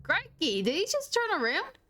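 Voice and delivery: half-joking Australian voice, surprised; a hint of an Australian accent, crikey and all. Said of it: "y'all really like your Australian accents".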